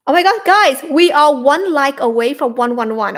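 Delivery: loud, bright burst of joy; excited. Real, not acted. Host thrilled to tell viewers the like count is almost there. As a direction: cheer at the like count. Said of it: "excited about live stream likes".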